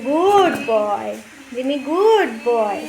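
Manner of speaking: very slow, loud drawn-out praise; praising the dog. Real, not acted. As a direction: stretch out the praise like petting the dog. (খুব ধীরে টেনে টেনে আদুরে প্রশংসা)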